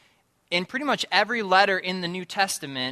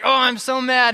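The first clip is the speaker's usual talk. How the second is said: mock mad; 8 semitones higher, 9 dB louder than usual. Voicing someone angry before prayer.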